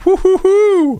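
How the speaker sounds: high gleeful woo-hoo; celebratory whoop. Real, not acted. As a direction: cheer it like you won.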